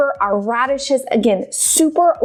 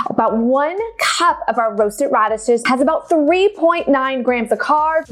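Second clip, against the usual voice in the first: ordinary talk, nothing marked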